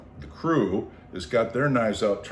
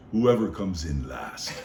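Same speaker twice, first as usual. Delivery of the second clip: lower, slow, dry delivery; deadpan joke; 4 semitones lower, slower than usual. Retold or imitated. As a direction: drop the line dead straight. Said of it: "And he looks at me and goes".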